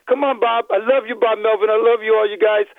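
ordinary delivery

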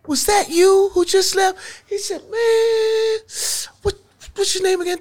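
squeaky excited disbelief; excited high exclaim. Retold or imitated. Fat Doctor greeting the new comic, high and excited. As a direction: go way up in pitch, delighted. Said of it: "he says".

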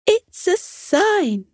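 high, slow and wondering mother; mother's voice. Acted. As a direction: breathe it out like a delighted mum. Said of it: "said Elliot's mother".

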